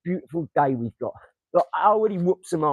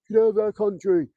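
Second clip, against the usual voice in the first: mock bigot shout; 6 semitones higher, 4 dB louder than usual.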